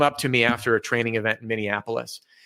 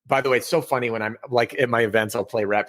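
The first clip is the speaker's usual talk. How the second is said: usual speaking voice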